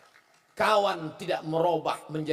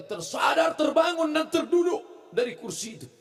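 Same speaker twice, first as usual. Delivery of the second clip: higher, louder, building climax of the miracle story; excited storytelling; 7 semitones higher, 3 dB louder than usual. A narrator's reading. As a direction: shout it out as the patient jolts awake. (penceramah bercerita dengan suara tinggi dan keras penuh semangat)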